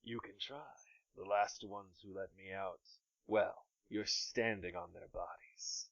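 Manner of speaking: soft, distant sinister threat; menacing smoke creature. Acted.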